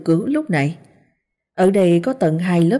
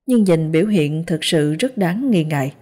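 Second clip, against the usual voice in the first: ordinary delivery, nothing marked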